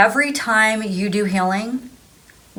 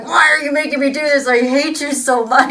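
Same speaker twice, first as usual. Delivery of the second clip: higher mock-angry complaint; mad at God; 6 semitones higher than usual. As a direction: whine your fury at God, half-joking. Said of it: "really mad at God".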